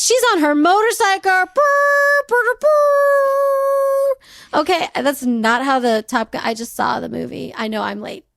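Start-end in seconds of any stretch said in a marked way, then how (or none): 0.0-4.6 high, loud excited motorcycle revving; excited high-pitched engine imitation; motorcycle noises. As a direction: play at riding a motorcycle, loud drawn-out brrs.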